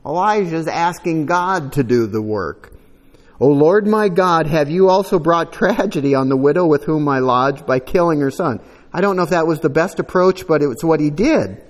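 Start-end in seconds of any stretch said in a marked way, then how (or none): 3.4-8.6 raised, louder reading voice; solemn Bible reading; scripture reading. Acted. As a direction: read the passage out to the church. Reads scripture aloud.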